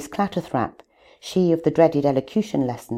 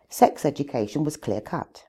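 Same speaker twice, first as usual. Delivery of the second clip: normal talking voice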